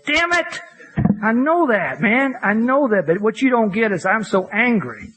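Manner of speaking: high, exasperated cry of frustration; an angry man snapping at his sponsor that he already knows; frustrated outburst. Retold or imitated. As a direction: blurt it out high and fed up.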